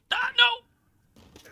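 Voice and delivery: very high startled yelp; real jump-scare shriek. Real, not acted. Sudden in-game scare.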